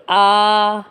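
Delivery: आ held out long and level; long vowel. Shown as a demonstration. Teacher showing double-length vowel, आ drawn out steadily. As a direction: stretch आ to double the short sound. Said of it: "ह्रस्व स्वरों से दुगना समय लगता है".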